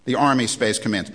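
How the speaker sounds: usual speaking voice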